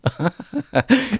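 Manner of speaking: bright burst of laughter; high laugh. Real, not acted. Laughing at bot answer.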